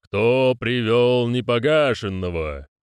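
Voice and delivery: slow, booming ceremonial challenge; drawn-out solemn thunder. Acted. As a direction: boom it out slowly from on high. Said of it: "в вышине прогремел голос".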